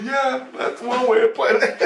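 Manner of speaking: high-pitched words through laughter; laughing. Real, not acted. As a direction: say it while laughing. Laughing at the dream absurdity.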